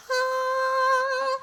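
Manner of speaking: long, high, sustained "ah"; loud high drone, mimicking background music; imitated trailer music. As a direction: hold a high choral-style note like trailer music. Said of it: "then there's music, like".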